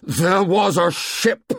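high, loud, slow savage shout. A narrator's reading. Ralph shouting savagely at Jack about the ship. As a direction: hurl it at them in fury. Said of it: "His voice was loud and savage".

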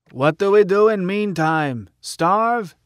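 high, querulous questioning; peevish heckler. A narrator's reading. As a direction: challenge the speaker peevishly, pitch climbing. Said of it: "Same peevish voice".

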